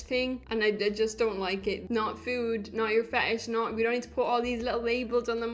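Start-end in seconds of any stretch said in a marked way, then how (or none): none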